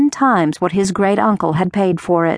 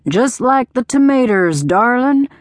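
higher-pitched, exaggerated country twang; exaggerated country accent; 5 semitones higher than usual. A narrator's reading. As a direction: lay on a thick country accent. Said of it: "in his exaggerated country accent".

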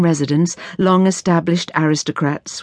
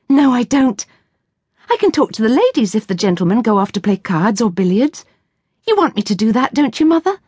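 put-on higher, bright girlish voice set against the narration; a keen seventeen-year-old making her case; eager young daughter, lightly voiced; young lady Ruth; 6 semitones higher than usual. Ruth's voice held by narrator.

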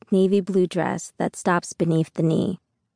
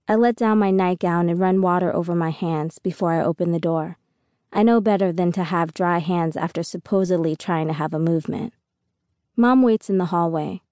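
plain reading voice

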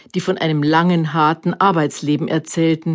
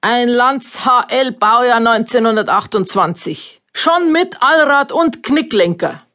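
announcer voice; 6 semitones higher than usual. A narrator's reading. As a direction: call it out like a fairground announcer. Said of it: "rief der Ansager".

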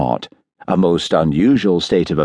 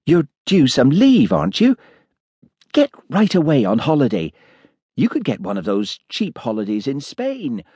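lightly voiced village woman, higher pitch; doctor's wife, mild; 7 semitones higher than usual. A narrator's reading. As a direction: explain mildly in a slightly raised woman's voice.

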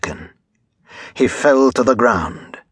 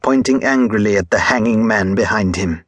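usual speaking voice

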